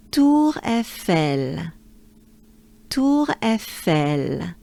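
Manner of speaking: slow, each sound pulled apart; slow Tour Eiffel. Shown as a demonstration. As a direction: Tour Eiffel stretched out, separate syllables. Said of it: "Let's take a good breath".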